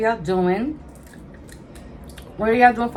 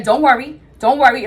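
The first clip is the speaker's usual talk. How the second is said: raised, pushy repeat of don't worry; loud mock reassurance; 5 semitones higher, 8 dB louder than usual. Son hyping himself up before confronting mother, loud. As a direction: hype yourself up, higher and louder. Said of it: "he's like".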